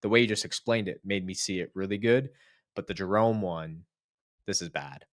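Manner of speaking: normal talking voice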